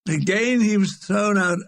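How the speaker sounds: ordinary delivery, nothing marked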